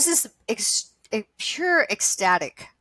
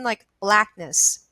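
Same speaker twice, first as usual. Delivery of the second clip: ordinary talk, nothing marked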